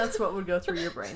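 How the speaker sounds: high voice broken by laughter; laughing while talking. Real, not acted. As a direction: say it through giggles. Co-host laughs at the punchline.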